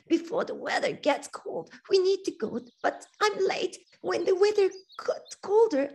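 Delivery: lightly voiced, high, plaintive bird; a wounded little bird asking for help; hurt swallow.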